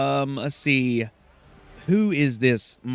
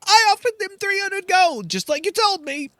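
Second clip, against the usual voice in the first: much higher, timid servant squeak; Garrick; 5 dB louder than usual. Acted. Garrick reassuring Silke, high timid voice. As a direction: cower a little before your mistress. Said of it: "And Garrick goes".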